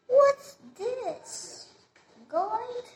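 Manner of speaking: high, loud squeal of delight with a laugh; Rapunzel delighted. Acted. Rapunzel finds the gold, squealing high with laughing delight. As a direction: gasp over the gold like a thrilled little girl. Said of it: "And she said like this".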